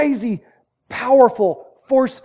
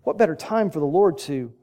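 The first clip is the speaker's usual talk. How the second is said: usual speaking voice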